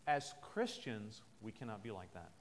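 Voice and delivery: ordinary delivery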